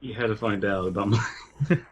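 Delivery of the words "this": ordinary talk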